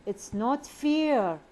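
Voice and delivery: slow, high and loud, with a long rise-fall on "fair"; exaggerated complaint. Shown as a demonstration. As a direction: whine it out slowly, stretching the fall on "fair". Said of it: "the rise and fall".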